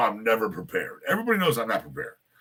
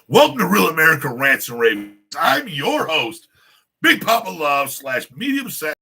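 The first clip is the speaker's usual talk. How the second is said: loud, high, hyped show opener; showman host intro; 5 semitones higher, 8 dB louder than usual. Acted. Host welcoming his viewers, loud and pumped. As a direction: open the show like a big-time host.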